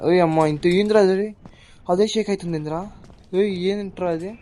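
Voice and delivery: shocked outburst at a sudden fright; startled exclaim. Real, not acted. Something shaking, sudden fright. (ఉలిక్కిపడి ఆశ్చర్యంగా)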